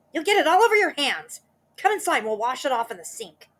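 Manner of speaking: high, loud scolding hag voice; bossy Mrs Twit. Acted. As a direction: order him inside. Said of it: "cried Missus Twit".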